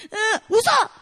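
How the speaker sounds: loud mock whine snapped into an order; whimper, then burst out brightly; imitating fussy kids told to switch; whine into command. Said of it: "Alethea's like".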